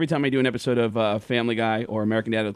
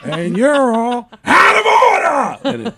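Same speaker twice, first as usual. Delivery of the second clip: slow, much higher Pacino yell; Al Pacino shout; 10 semitones higher, 9 dB louder, much slower than usual. Acted. Pacino among broken washers, peak of the shouted rant. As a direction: blow up the last line full Pacino. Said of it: "they cut to me as Al Pacino".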